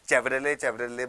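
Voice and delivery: Chevrolet said with a hard "ch", as chev-ruh-lay; common mispronunciation. Shown as a demonstration. Teacher quoting how many people say it, mispronounced with "ch". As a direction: start Chevrolet with the ch of "chair". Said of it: "बहुत सारे लोग इसको".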